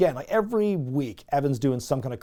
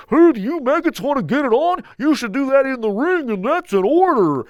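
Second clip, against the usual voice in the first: goofy put-on Santa voice; 10 semitones higher, 7 dB louder than usual. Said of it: "Goofy or Barney, the way he's putting on this voice".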